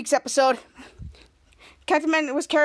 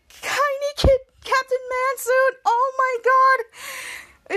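much higher, "Oh my God" dragged very slow; gushing over cuteness; 9 semitones higher, much slower than usual. Real, not acted. Fan reacting to a dog in costume, gushing. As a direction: melt over something adorable.

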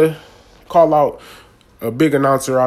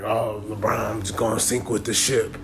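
quiet, snide mock of a pundit's prediction; mocking imitation; 4 semitones lower, 8 dB quieter than usual. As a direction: sneer it under your breath like a know-it-all.

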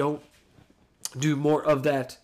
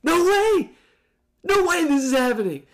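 no way, building to a high loud yell; 7 semitones higher, 5 dB louder than usual. Retold or imitated. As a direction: shout in disbelief, louder each time. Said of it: "I was yelling at the T V".

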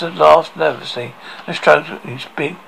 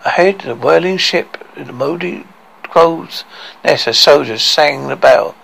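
plain reading voice

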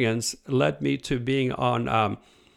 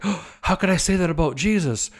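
shocked; 8 semitones higher than usual. Retold or imitated. Shocked at own words.

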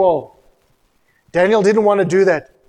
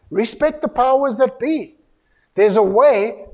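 fiery preaching; 3 semitones higher than usual. Acted. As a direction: scold the congregation with fire. Preacher rebuking protesters at full force.

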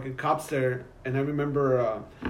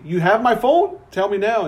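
raised, insistent demand; confronting the gas station clerk, raised demand; 6 semitones higher, 6 dB louder than usual. Retold or imitated. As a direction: demand the phone back, louder and higher. Demanding phone back from clerk.

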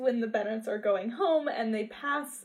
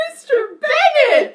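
shocked laughing cry; 15 dB louder than usual. Retold or imitated. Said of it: "we're just like".

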